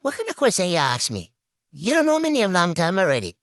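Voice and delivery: ordinary delivery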